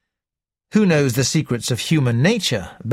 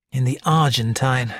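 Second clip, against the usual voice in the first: ordinary delivery, nothing marked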